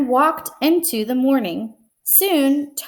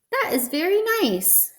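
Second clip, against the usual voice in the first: Frog's voice; 9 semitones higher than usual. Acted. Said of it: "said Frog".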